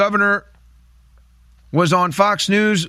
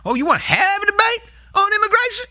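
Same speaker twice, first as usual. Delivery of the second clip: mocking catty voice, pitched far higher. Host mocking the Vice President's question in a high catty voice. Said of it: "her catty voice there".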